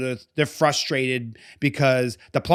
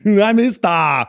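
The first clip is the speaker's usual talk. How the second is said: slow, heavy action-hero wizard; mock the friend's booming wizard voice; Arnold Gandalf voice; 3 semitones higher, 5 dB louder, slower than usual. Teasing the friend by echoing his Gandalf line in an action-hero voice. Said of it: "You Arnold".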